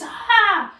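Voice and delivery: loud high shriek of shock; startled scream. Retold or imitated. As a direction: cry out as cold water hits you. Drenched old woman screams below.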